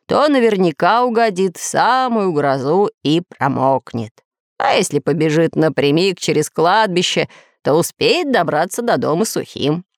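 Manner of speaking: ordinary delivery, nothing marked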